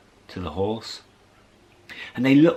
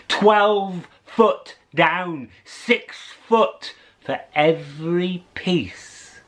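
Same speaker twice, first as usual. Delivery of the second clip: slow dramatic; 7 semitones higher, much slower than usual. A narrator's reading. Dramatic storytelling emphasis.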